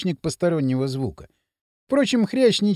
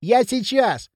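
higher-pitched distant call; raised, carrying reply; calling back; 5 semitones higher, slower than usual. A narrator's reading. Boy calling back across the ravine.

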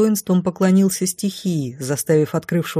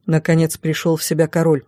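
ordinary delivery

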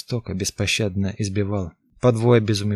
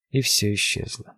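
usual speaking voice

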